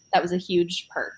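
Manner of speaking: normal talking voice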